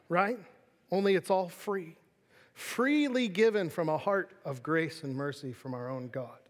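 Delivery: usual speaking voice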